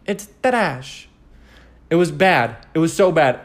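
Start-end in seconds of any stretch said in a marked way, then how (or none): 0.0-1.1 higher, Italian-tinged trash as ta-RASH; mock Italian accent. Shown as a demonstration. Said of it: "Is that Italian?".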